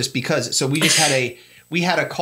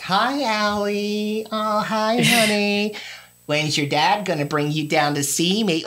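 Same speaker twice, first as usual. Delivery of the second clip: higher sweet grandma voice, slow; imitating mom; 6 semitones higher, slower than usual. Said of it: "she's like".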